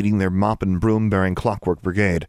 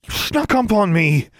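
high, slow, drawn-out angry outburst; snarled; 13 semitones higher, 4 dB louder than usual. A narrator's reading. Startled, embarrassed girl turning on the house elf, snarling. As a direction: snarl it at whoever startled you. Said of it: "Alexandra snarled".

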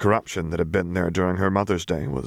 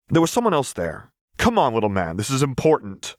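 higher, louder, pressing hard; insistent; 6 semitones higher, 3 dB louder than usual. Acted. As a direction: push him for the answer. Vi pushing Echo.

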